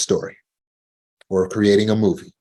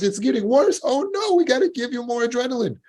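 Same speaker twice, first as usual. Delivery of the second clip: very high squeaky mock alarm; hammed-up alarmed brain. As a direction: squeal it like a panicked cartoon. Voicing alarmed subconscious.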